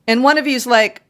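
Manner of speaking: ordinary talk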